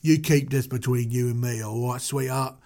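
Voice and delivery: put-on low British tough-guy accent. Moses swearing the Hebrew to secrecy, low British accent.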